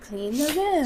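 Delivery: soft, quiet star voice, lightly imitated; hushed little instruction. Host imitating a singer's instructions, soft impression.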